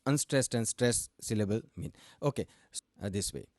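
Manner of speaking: ordinary delivery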